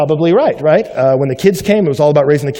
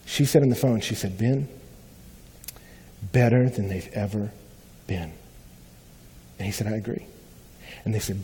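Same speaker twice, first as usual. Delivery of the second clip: hushed, soft retelling; soft quoted recollection; 3 semitones lower, 7 dB quieter than usual. Retold or imitated. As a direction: pull the voice down, tender. Couple's tender story quoted.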